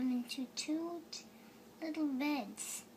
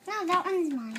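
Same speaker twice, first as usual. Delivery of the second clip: louder, higher insistence; insistent; 5 semitones higher, 7 dB louder than usual. Real, not acted. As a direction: insist harder. Repeated insistence.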